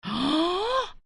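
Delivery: sharp rising gasp of shock. Acted. Mother-in-law sees the electricity bill, shocked gasp. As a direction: gasp as if the bill stunned you. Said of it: "घबरा जाती है".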